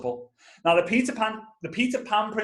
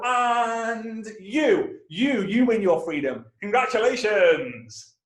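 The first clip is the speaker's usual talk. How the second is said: mock-grand announcer with drawn-out congratulations; Julius Caesar; 4 semitones higher, slower than usual. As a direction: proclaim a winner, stretching the words. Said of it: "Julius Caesar doesn't just go".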